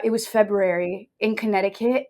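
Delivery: ordinary talk, nothing marked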